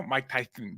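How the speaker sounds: lisping like Mike Tyson; soft lisp on the s. Real, not acted. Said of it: "I have a lisp now".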